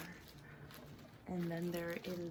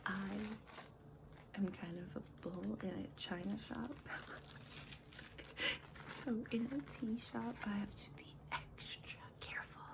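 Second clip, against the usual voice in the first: whispering; 3 semitones higher than usual. Acted. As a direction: whisper it close to the mic. ASMR whisper about tea.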